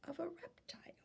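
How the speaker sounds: softly whispered reading. Real, not acted. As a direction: read it in a breathy whisper. Whispering while reading the book.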